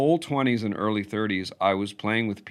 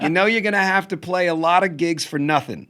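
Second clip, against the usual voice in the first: high-pitched mock-mother warning; funny higher voice imitating mother's advice; 8 semitones higher than usual. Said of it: "my mother's funny voice".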